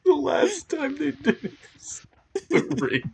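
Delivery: high, loud helpless laughter; laughing hard. Real, not acted. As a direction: crack up mid-sentence. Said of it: "laughing fit".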